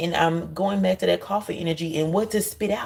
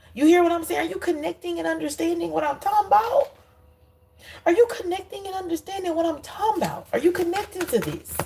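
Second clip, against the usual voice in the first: urgent high-pitched checking in; 9 semitones higher than usual. Real, not acted. Insistently checking viewers are connecting.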